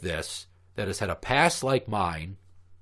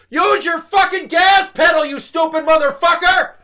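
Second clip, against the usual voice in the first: furious high shout at another car; full-throated road rage scream; screaming at a driver; 12 dB louder than usual. Retold or imitated. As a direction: yell it out the car window in rage. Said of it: "And I'm screaming".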